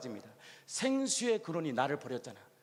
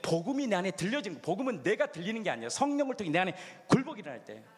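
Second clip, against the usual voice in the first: ordinary talk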